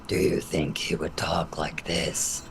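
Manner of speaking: very deep, slow, whispery monster voice; Jabba the Hutt-like voice. Shown as a demonstration. Actor surprising the producers with her Jabba-like creature voice. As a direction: drop way down into a slow, huge creature voice. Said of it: "some sort of Jabba the Hutt-like character".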